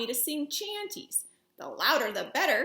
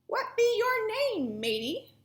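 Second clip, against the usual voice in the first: pirate asking; 8 semitones higher than usual. A narrator's reading. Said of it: "the head pirate asked".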